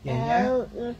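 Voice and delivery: high cooing yeah yeah. Real, not acted. Mother answering her fussing toddler, high coo. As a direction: soothe a little one, pitch way up.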